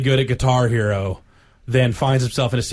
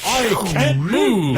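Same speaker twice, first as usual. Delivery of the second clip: high, loud, very slow game voice; comic book voice; 8 semitones higher, 4 dB louder, much slower than usual. Retold or imitated. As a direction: drag it out like a Captain America game line. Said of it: "it's that voice".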